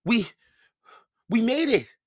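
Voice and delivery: stammered, high and cold; teeth chattering. Acted. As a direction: stutter from shivering cold. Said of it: "Her teeth were chattering too".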